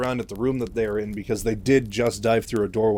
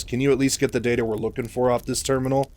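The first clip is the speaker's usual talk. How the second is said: ordinary delivery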